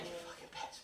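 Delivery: whispered swearing under breath; hissed curse. Retold or imitated. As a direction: hiss it out in pain. Bitten by ferret, cursing.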